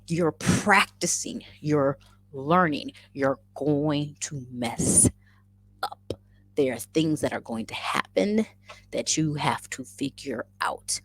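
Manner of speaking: slow, spaced-out imitation of advice; measured grounding lecture. Mother grounding her crying daughter, slow measured lecture. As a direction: spell it out slowly, phrase by phrase.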